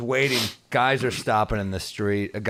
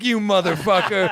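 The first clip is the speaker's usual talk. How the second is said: laughing angry yell; 8 semitones higher, 5 dB louder than usual. Retold or imitated. Retold fight, laughing.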